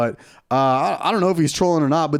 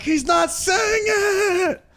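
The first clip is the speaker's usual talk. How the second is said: high, drawn-out mock wail; listeners' exasperated complaint; much slower than usual. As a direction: stretch it out high like whining listeners. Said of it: "The girls are listening at home like".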